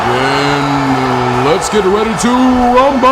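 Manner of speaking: ring announcer. Acted. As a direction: stretch it out then blast rumble like a boxing announcer. Announcer hypes the crowd.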